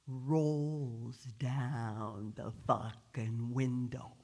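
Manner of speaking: very slow, stretched-out words; slow as possible. A narrator's reading. Narrator shows the driver irritating the cop, extremely slow. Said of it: "slowly as possible".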